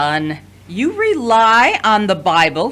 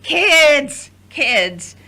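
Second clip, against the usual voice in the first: scolding call; 6 semitones higher, much slower than usual. Acted. Mother breaks up sibling fight.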